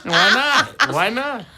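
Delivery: high-pitched question through laughter; laughing. Real, not acted. Friend laughing at the refusal to hit him up, laughing. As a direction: ask it helplessly laughing, voice way up.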